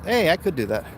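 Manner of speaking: usual speaking voice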